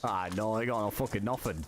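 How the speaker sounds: hammed-up British lad accent, lightly done; troll as a cheeky British bloke; fake British accent. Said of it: "I'm fucking talking like him".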